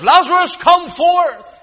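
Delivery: very high, full-voiced shout; commanding call. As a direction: call the dead out of the tomb. Jesus calling the dead Lazarus out.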